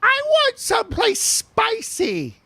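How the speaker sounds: very high, stretched put-on voice; high, drawn-out mimicked demand; tipsy woman impression. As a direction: imitate her in a slow squeal. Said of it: "she was talking a bit like this".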